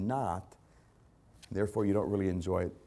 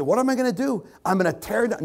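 high, loud acted excitement; worked-up, high-pitched planning aloud; excited rich man; 11 semitones higher, 7 dB louder than usual. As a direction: play a man thrilled with his plan.

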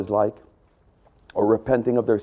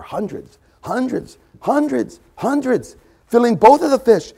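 excited build; 10 semitones higher than usual. Real, not acted. Building emphasis on hundreds.

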